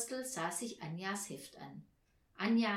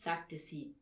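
plain reading voice

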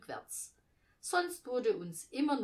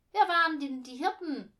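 high, loud demand to know; brash kid's question; 8 semitones higher, 10 dB louder than usual. A narrator's reading. Leopold interrupts rehearsal wanting to know about shepherds, brash and loud. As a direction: blurt it out like a pushy child. Child bluntly demands an explanation.

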